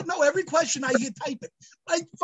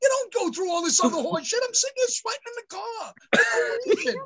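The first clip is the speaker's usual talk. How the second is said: much higher, furious mock tirade; retold angry outburst; 9 semitones higher than usual. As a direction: rant at the lab like you're fed up. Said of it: "I go".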